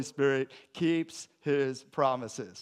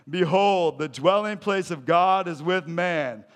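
loud, ringing scripture proclamation; preaching at full force; 3 semitones higher, 8 dB louder than usual. Acted. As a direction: announce it to the whole room. Reading scripture aloud to the congregation.

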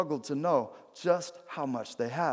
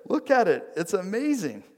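acted proud excitement, pitched up and punchy; excited showing off; 5 semitones higher than usual. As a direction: show off a prized treasure, voice lifted. Veteran shows off his prized paper.

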